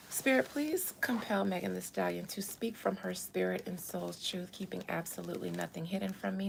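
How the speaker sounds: breathy seance whisper. Pretend. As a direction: whisper the incantation softly. Conjuring spirit.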